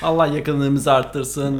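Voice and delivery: slow mock blessing.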